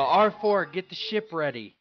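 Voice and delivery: urgent yell to a crewmate; yelled order. Acted. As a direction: call out loudly to the droid, high at first, then easing off. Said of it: "So I yell".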